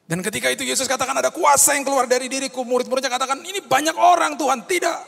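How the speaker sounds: high, loud, fast preaching with Jesus and disciples voiced; full-force preaching. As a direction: drive the story home at the top of the voice. Preaching at full force over music. (khotbah berapi-api dengan suara tinggi dan keras)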